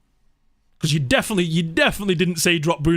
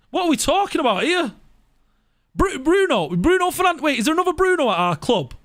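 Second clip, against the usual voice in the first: higher, incredulous, pressing the question; stunned at the very idea; high-pitched indignant disbelief; outraged disbelief; 7 semitones higher than usual. Real, not acted. Outraged at dropping the star player.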